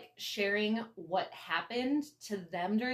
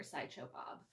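whispered name; 14 dB quieter than usual. Retold or imitated. Telling off the vodka guest, whispered name. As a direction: whisper the name, much softer. Scolding absent guy aside.

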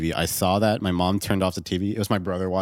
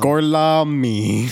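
slow, louder hammed fake Italian "Gorlami"; bad Italian accent; 5 semitones higher, 7 dB louder, much slower than usual. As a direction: drag out "Gorlami" in mock Italian. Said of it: "Brad Pitt tries to speak Italian".

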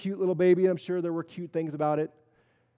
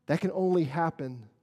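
normal talking voice

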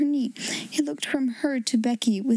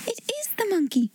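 quick, high burst of discovery; delighted exclamation without extra volume; excited cry; 7 semitones higher, faster than usual. A narrator's reading. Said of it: "she cried out".

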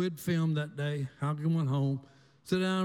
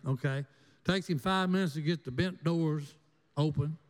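usual speaking voice

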